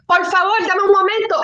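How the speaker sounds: high, urgent plea in Spanish; retold begging. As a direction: re-enact begging for a moment. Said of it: "I'm still begging her".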